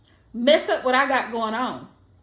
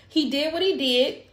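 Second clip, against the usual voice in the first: normal talking voice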